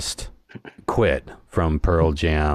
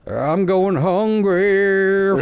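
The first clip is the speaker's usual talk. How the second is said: high, slow, drawn-out silly voice; mock the caller, stretched out; goofy phone voice; 14 semitones higher, 3 dB louder, much slower than usual. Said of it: "the phone call being like".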